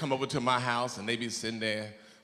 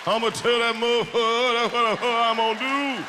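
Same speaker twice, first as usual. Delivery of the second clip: Bernie Mac voice; 7 semitones higher than usual. Acted.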